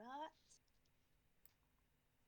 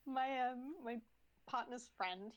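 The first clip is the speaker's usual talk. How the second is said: ordinary delivery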